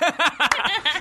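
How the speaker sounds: high burst of laughter; big laugh. Real, not acted. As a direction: laugh out, delighted. Reacting to butter voice review.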